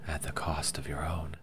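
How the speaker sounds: soft whispered afterthought; unspoken whisper. A narrator's reading. Mayara thinking it at Agala's side, whisper. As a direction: breathe it, not meant to be said aloud. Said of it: "She didn't say that part aloud".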